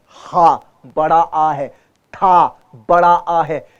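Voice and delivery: हा and था held long with a full आ. Shown as a demonstration. Teacher stretching the long aa for students. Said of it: "छोटा अ है".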